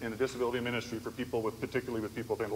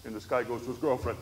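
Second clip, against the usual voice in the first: ordinary talk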